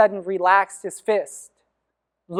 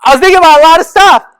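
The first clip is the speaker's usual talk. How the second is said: higher, much louder and fast; loud emphatic; 7 semitones higher, 12 dB louder, faster than usual. Shown as a demonstration. Telling the audience with loud emphasis. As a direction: belt it out for the crowd. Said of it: "Let me tell you that".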